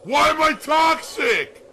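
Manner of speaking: high, slow mocking yell; mock shout. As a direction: shout it out like a taunting meme. Acting out the meme taunt.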